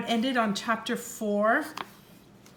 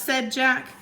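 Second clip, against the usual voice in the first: ordinary delivery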